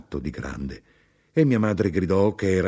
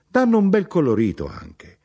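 eager praise; 13 semitones higher, 6 dB louder than usual. A narrator's reading. Mother praising herrings.

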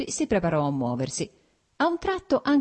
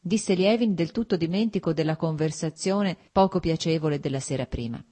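usual speaking voice

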